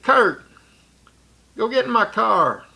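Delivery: put-on older sibling ordering the brother away, firm and loud.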